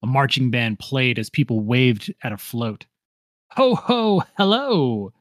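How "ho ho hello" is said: put-on much higher, sweet old lady call; Mrs Claus. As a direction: greet the crowd like Mrs Claus. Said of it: "Missus Claus called".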